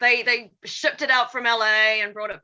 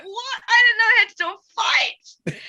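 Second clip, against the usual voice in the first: high, loud startled outburst; shocked; 11 semitones higher, 5 dB louder than usual. Retold or imitated. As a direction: blurt it out in disbelief. Retold shock at surprise fight.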